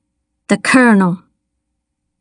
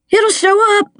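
panicked shout; 11 semitones higher than usual. A narrator's reading. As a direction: blurt it high, in a panic. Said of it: "his voice raw and panicked".

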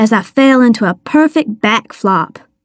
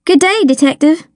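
brisk, higher, composed politeness; professional greeting; 6 semitones higher, faster than usual. A narrator's reading. Child greeting the detective at his crime scene, professional. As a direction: greet formally, quick and businesslike. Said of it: "keeping my voice professional".

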